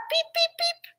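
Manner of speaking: very high chick peeps; chicks peeping. A narrator's reading. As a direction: peep like tiny chicks. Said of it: "her little chicks woke her up".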